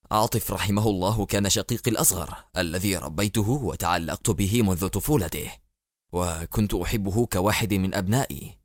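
steady his grief and stay resolute; grave president. Acted. President speaking to his minister about his dead brother, low and controlled.

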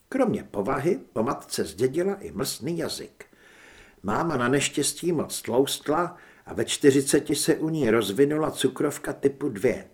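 plain reading voice

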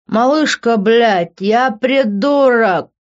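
put-on loud, slow drunk man; high, sloppy drunken wail; drunken slurring. A narrator's reading. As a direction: drag the words with a thick tongue. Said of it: "еле ворочая языком".

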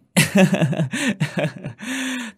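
a full hearty laugh; big hearty laugh. A narrator's reading. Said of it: "Ông bác tôi cười to".

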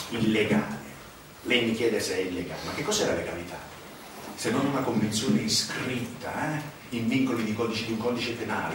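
low, mocking questions to the listener; a sly man justifying crime; cynical insider.

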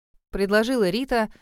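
plain reading voice

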